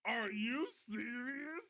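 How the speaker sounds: high, slow, strained are-you-serious; genuinely floored by the news; squeaky incredulous question; stunned disbelief. Real, not acted. Live access removed, reacting.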